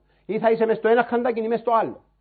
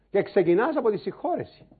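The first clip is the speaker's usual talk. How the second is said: normal talking voice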